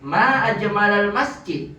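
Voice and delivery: MA held long and high, falling on MASJID; slow, high cry of wonder at the masjid; exclamation intonation. Shown as a demonstration. Teacher leads students to repeat, exclamatory intonation. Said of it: "kemudian intonasinya".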